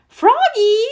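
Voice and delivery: high, loud call across the house. Acted. Mother calls after Froggy running out, high and loud. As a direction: call him back from the door. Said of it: "called his mother".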